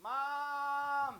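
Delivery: put-on high, thin child calling for mom; small child calling for mom in a supermarket; lost little kid. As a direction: call out like a lost toddler. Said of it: "just to go".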